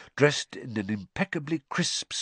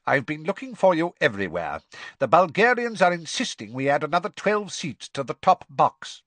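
higher, insistent, fussy complaint; bite of impatience; 7 semitones higher, 3 dB louder than usual. A narrator's reading. Crouch has looked everywhere for Ludo, impatient. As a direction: press the business with an edge. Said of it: "bite of impatience in his voice".

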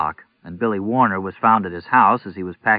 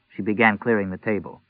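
ordinary delivery

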